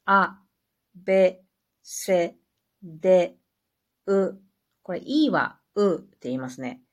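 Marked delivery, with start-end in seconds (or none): 0.0-4.4 reciting the French alphabet one letter at a time; careful letter-by-letter reading; French letters read aloud. Shown as a demonstration. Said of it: "ちょっと私発音は不確かですけど読んでいきますね". (フランス語のアルファベットを一文字ずつ読み上げる)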